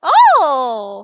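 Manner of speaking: loud delighted oh; loud surprised oh at the roll; excited gasp. Real, not acted. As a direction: react with a big burst of surprise. Dice roll result excitement.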